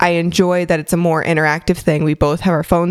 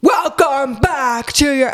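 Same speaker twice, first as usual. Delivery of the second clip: stretched-out, higher mock host greeting; overexcited intro voice; 7 semitones higher, slower than usual. Co-host mocking the partner who came out hot, overexcited intro voice. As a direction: tease with a drawn-out, hyped-up show opener. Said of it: "we're on that voice again".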